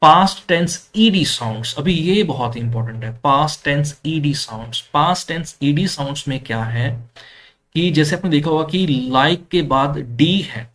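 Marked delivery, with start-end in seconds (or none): none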